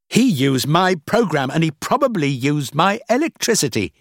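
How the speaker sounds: high, indignant, emphatic grumble; angry complaint. A narrator's reading. Boss accusing Mark of using his things, indignant. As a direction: complain as if robbed.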